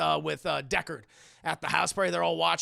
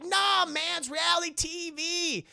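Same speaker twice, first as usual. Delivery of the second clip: mock dismissive; 8 semitones higher, slower than usual. [name] arguing back in retelling.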